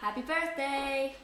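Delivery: slow, loud birthday cheer; loud birthday greeting. Real, not acted. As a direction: call out happy birthday to mum. Greeting mum arriving home.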